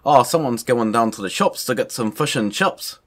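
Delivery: put-on New Zealand accent; mock New Zealand lilt. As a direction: imitate a Kiwi accent with clipped-in vowels. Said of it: "it's like the New Zealand accent".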